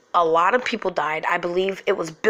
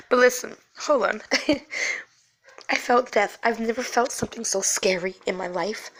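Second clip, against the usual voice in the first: crying softly while talking; real tearful voice; 4 dB quieter than usual. Real, not acted. Mother recalling the scariest moment of her life, tearful. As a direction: push on through tears, voice thin and unsteady. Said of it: "I'm sorry, I started crying".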